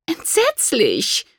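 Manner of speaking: high, drawn-out groan; long wail of dismay; groaned in horror. A narrator's reading. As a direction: groan it slowly, appalled. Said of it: "stöhnte seine Gemahlin".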